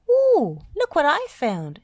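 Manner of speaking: very high, loud excited squeal; thrilled little find; excited squeak. A narrator's reading. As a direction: squeak it out with delight. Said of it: "she squeaked excitedly".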